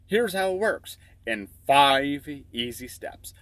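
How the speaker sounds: normal talking voice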